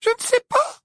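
very high-pitched frightened squeal; terrified squeak. Acted. Hermione answering the roaring giant, squeaking in terror. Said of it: "couina-t-elle, terrifiée".

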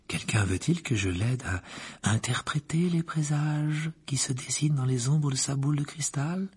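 murmuring seer. A narrator's reading. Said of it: "murmura-t-elle".

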